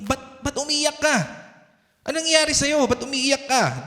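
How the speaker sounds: high-pitched questioning, imitating a parent; concerned parent. Parent asking a crying child what happened, high concerned questioning. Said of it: "Di ba ganun yung mga parents natin".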